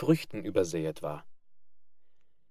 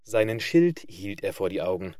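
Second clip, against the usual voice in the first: usual speaking voice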